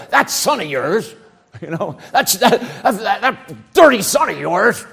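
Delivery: mock sneer at "that son of yours"; hammed-up resentful elder brother. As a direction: spit out the brother's name in contempt. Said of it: "he said".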